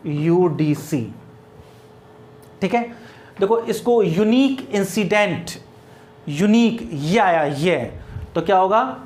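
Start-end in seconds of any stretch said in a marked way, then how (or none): 3.4-7.8 slow unique. Shown as a demonstration. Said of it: "teacher says unique slowly".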